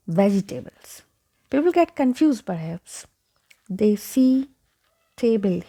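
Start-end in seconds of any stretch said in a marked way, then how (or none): 0.1-1.0 vegetables as VEJ-ee-TAY-bles; mispronunciation. Shown as a demonstration. Teacher shows the common error, mispronounced vegetables. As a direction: end "vegetables" like the word table. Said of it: "So it is not".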